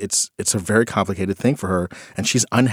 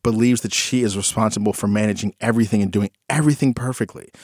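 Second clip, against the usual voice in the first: normal talking voice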